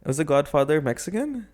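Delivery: ordinary delivery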